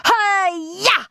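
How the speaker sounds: high-pitched martial arts cry; wild, high hi-ya; karate shriek. A narrator's reading. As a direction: shriek it like a boy doing a karate chop. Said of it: "shrieked Henry".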